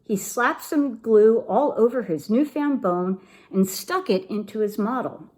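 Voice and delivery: usual speaking voice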